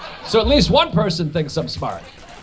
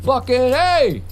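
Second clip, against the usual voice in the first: hammed-up Italian cry of Picasso!, a bit higher; mock Italian exclamation; 4 semitones higher, much slower than usual. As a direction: call out Picasso! like a proud Italian, lightly. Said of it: "I hear O, I think Italian".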